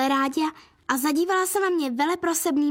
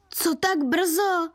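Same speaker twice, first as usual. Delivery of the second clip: drawn-out, lightly called question; mild halloo; 3 semitones higher, much slower than usual. A narrator's reading. Coach greets the early girl, lightly hallooing. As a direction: call it out slowly, without much volume. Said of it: "zahalikal na mě zkukaně pan Sirůček".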